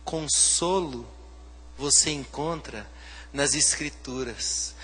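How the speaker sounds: slow, soft, each phrase set apart; slow emphatic repeat. Shown as a demonstration. Pastor repeating the verse slowly to the church, soft. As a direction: drive it home word by word. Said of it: "slow emphatic repeat".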